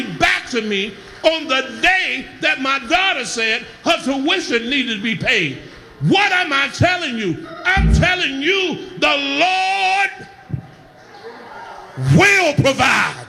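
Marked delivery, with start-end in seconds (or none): 6.0-13.2 raised, ringing preacher's cry over the organ; full-force preaching. Acted. Preaching, shouting over music.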